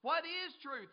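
loud, high mocking question; sarcastic scoff. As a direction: hurl it as a sceptic's taunt. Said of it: "he was sarcastic".

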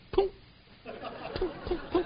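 soft, high-pitched "poom" noises; little popped poom sounds, high and quiet; imitated pooms.

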